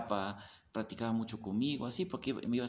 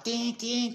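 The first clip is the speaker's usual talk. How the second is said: high, louder tin tin like a struck bell; bell rung hard; 11 semitones higher, 3 dB louder than usual. Retold or imitated. Imitating the guru ringing his bell hard, high and insistent. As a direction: bang out the bell sound urgently. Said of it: "estaba pegándole duro a la campana".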